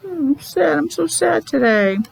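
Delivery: teacher pretending to be sad; mock sad. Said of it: "But I'm not really".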